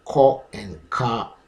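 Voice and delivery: "Co" rounded, then "ka" open, said slowly; contrasted syllables. Shown as a demonstration. Teacher showing the difference, two slow contrasted syllables. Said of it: "The difference is the first syllable".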